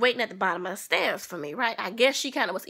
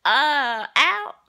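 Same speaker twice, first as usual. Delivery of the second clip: defiant; 3 semitones higher, 6 dB louder than usual. Retold or imitated. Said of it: "I said".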